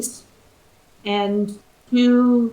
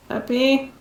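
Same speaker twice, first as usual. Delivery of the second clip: sleepy voice; 3 semitones higher than usual. Acted. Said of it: "a very sleepy voice call out".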